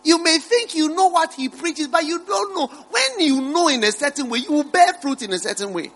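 fervent sermon delivery; preaching. Acted. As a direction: preach it out to the congregation.

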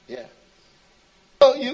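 ordinary talk, nothing marked

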